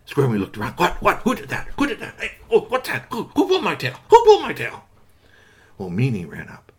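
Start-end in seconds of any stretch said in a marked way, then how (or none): 0.7-4.8 higher, squeaky startled squirrel; flustered squirrel demanding who did it. Acted. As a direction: look around in a fluster. Squirmy startled by tail pull.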